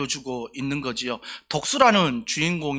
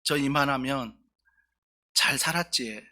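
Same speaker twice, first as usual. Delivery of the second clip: attempted Busan dialect; 2 semitones lower than usual. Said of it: "여기 부산 사투리로 해야 되는데 잘 안 돼요".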